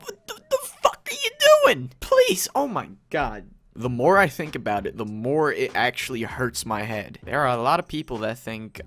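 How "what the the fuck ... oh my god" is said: much higher, cracking, half-laughing disbelief; real exasperated disbelief. Real, not acted. Reacting to cringe fan videos in squealing disbelief. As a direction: squeal it like you can't bear to watch.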